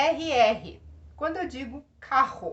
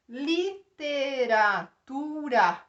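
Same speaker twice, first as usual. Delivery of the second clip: li-te-ra-tu-ra said slowly, syllable by syllable; split into syllables; 5 dB louder, much slower than usual. Shown as a demonstration. Teacher showing a five-syllable word, slow syllables. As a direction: count out literatura beat by beat. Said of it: "Cinco sílabas".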